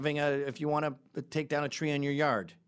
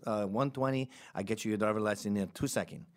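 hustler's put-on Mexican accent. Hustler selling a quick license, botched Mexican accent. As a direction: attempt an Italian accent and land on Mexican. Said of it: "I don't know why I'm doing Mexican".

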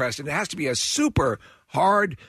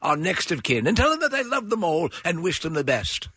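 higher-pitched, absurdly proper dying gentleman; mock stiff-upper-lip victim; 4 semitones higher than usual. Fainting victim asking to alert next of kin, mock stiff upper lip. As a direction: politely narrate your agony in hammed-up formal English. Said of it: "this stiff upper lip".

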